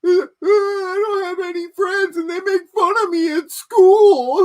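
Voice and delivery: high, slow, sobbing mock voice; mock crying boy. Mocking YouTubers who cry like boys, high sobbing voice. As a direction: whine it like a bullied kid. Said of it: "crying like twelve-year-old boys".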